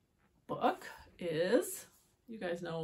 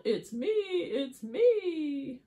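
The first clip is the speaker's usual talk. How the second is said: higher, stretched-out reveal; excited hen; 7 semitones higher, 7 dB louder, much slower than usual. Acted. Hen reveals itself under the mask, slow excited cry. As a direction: draw out each phrase gleefully. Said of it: "says the hen".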